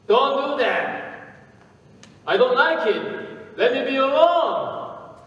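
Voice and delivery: high, loud cry of protest; yelled protest. A narrator's reading. Lump of clay yelling at its master to stop. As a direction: shriek it like clay being pounded. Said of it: "And I yelled out".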